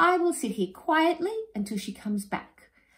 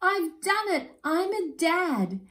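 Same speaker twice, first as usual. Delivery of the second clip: much higher, slow delighted crowing; proud joy; 13 semitones higher, 4 dB louder, slower than usual. Acted. New father announcing his chicks, overjoyed. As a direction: crow with pride.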